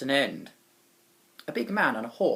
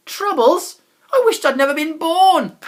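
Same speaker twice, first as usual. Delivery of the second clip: high, loud despairing outburst; exasperated cry; 10 semitones higher, 12 dB louder than usual. A narrator's reading. The narrator lamenting his troubles in the tunnel, loud cry. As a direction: wail at your bad luck.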